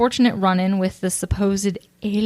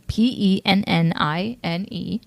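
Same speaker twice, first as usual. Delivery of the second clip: spelling out. Shown as a demonstration. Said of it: "spelling it out slowly".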